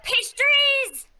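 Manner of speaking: high, loud yell of delight; excited shout. Acted. Running at Ori and the pastries, excited shout. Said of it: "I shout".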